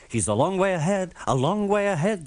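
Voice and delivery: anxious cry. A narrator's reading. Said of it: "they cried anxiously".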